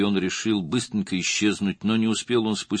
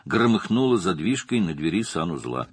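ordinary delivery

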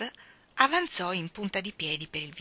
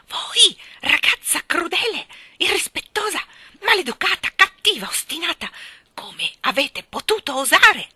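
high, loud, outraged accusations; distraught tirade; 10 semitones higher, 9 dB louder than usual. A narrator's reading. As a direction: hurl each insult at full pitch, beside yourself. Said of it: "balbettò la signorina Andrews sconvolta". (invettiva sconvolta, acuta e forte)

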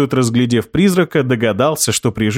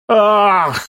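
passionate ghost gasp; 8 semitones higher than usual. Acted. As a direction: gasp out with relief. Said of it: "громко и страстно выдохнул".

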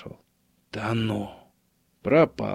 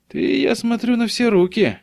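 high-pitched, wryly amused remark; amused jibe, pitched well up; wry snort; 11 semitones higher than usual. A narrator's reading. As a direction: needle a friend with a wry, raised-pitch remark. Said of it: "хмыкнул Мельниченко".

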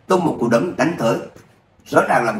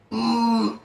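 strangled choking grunt; 11 semitones higher, 3 dB quieter than usual. Acted. Being strangled by throat grip.

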